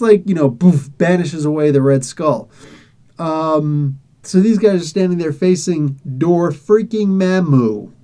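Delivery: ordinary delivery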